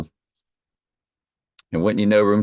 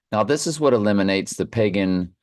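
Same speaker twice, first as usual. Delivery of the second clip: ordinary delivery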